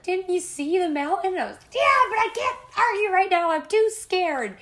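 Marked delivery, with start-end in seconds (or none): 0.0-1.3 high, loud mock disbelief; incredulous friend. Imitating the friend asking if she saw the mountain. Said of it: "And she was like". 1.7-4.6 high, loud scared cry; terrified wail. Retold or imitated. Telling her friend she's too scared to argue, terrified. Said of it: "And I was terrified".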